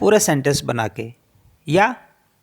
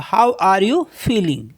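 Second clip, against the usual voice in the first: slow and clear, each word separate; slow model sentence; much slower than usual. Shown as a demonstration. Teacher modelling the question for learners, slow and careful. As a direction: lay it out slowly so they can repeat. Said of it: "कैसा feel कर रहे हो".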